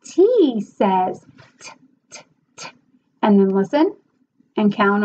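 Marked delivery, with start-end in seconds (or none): 0.0-1.7 very slow letter sound; slow phonics model. Shown as a demonstration. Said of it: "they're gonna say t". 2.1-2.7 breathy voiceless t sounds; just the bare consonant; whispered letter sounds. Shown as a demonstration. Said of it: "phonics sound demo".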